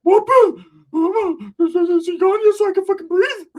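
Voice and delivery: high, loud gasping squeal; winded Ricky. Retold or imitated. Exhausted cousin refusing to show he's tired, high loud squeal. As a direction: wheeze it out high and loud, pretending not to be tired. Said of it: "looking like".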